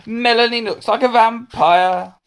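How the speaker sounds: high-pitched schoolboy jeer; shouted taunt. Acted. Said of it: "he shouted".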